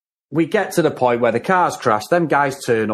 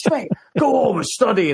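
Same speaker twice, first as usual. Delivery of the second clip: raised, scornful cop brush-off; dismissive copper; 8 semitones higher than usual. Retold or imitated. Cop sending Peter home, raised dismissive cop voice. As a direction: wave the kid off like a cliche cop. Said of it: "I'm doing his voice now".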